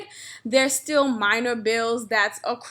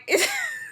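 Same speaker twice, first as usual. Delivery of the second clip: high squeal laugh; 5 dB quieter than usual. Real, not acted. Laughing at own joke.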